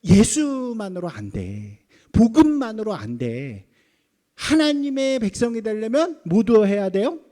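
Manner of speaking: slow, loud, drawn-out insisting; insistent Judaizer. Acted. Judaizer addressing Gentile believers, loudly and slowly insisting. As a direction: lay down the law, stretching each phrase. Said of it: "이방인들을 향해서".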